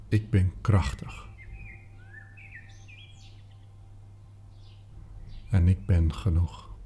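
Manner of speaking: hushed inward repetition, partly whispered; softly repeated. Shown as a demonstration. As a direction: repeat them gently to yourself. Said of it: "En herhaal ze zachtjes in jezelf".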